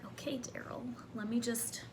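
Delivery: soft reply. Retold or imitated. Herself replying to salesman.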